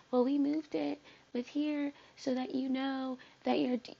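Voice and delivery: high-pitched put-on voice of the app's makers; mock explainer. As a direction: imitate them explaining it in a silly high voice. Mimics app makers explaining change to users.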